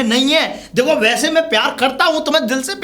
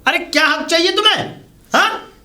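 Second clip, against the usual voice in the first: raised, higher-pitched indignant demand; angry demand; 5 semitones higher than usual. Acted. Husband challenging his wife over her rights, angrily. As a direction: fire the questions at her in anger.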